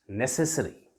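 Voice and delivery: ordinary talk, nothing marked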